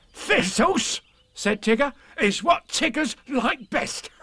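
high, bouncy tiger voice; eager Tigger, sure of himself. A narrator's reading.

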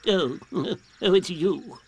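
grumpy owl shooing a caller, with hooting chuckle; slightly pompous owl character, words stretched out. A narrator's reading. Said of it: "Owl's head came out and said".

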